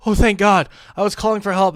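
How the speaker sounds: hammed-up relief; mock girl's voice. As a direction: imitate a girl feigning relief. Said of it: "And she's like".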